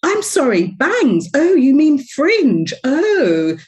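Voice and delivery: high, loud, playfully posh British English; hammed-up English accent for Americans; milking her British accent. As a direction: lay the London accent on thick. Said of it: "I milk it".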